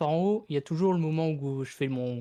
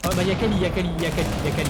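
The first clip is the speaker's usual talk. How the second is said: excited callout; 2 semitones higher, 5 dB louder, faster than usual. Real, not acted. Excited enemy callout in match.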